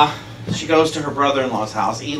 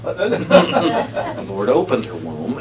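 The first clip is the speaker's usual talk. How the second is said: ordinary delivery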